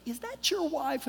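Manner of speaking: high, soft question; young woman's voice. Retold or imitated. Seated girl asking a stranger, high soft voice.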